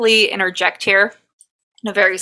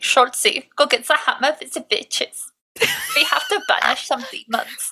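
put-on high-pitched German accent, rising higher; German grandma accent; 6 semitones higher than usual. Host imitating grandma ordering demons banished, hammed-up German accent. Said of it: "talk how my, like, grandma will talk".

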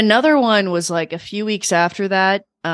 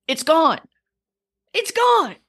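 high-pitched amazement, rising higher on the repeat; amazed; 9 semitones higher, slower than usual. Real, not acted. Telling the co-host the balloon vanished, amazed. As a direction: land the astonished reveal, higher the second time. Climax of amazing balloon story.

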